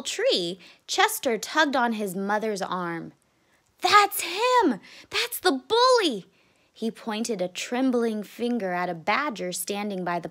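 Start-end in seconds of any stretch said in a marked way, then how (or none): 3.8-6.3 high-pitched, urgent cub cry; alarmed child. Acted. Chester spots the bully by the pond, alarmed. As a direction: point out the danger in a high voice.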